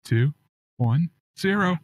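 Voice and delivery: drawn-out countdown, high; slow countdown. Shown as a demonstration. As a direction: count down slowly to air. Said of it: "countdown to going live".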